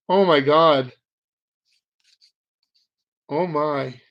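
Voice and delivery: ordinary talk, nothing marked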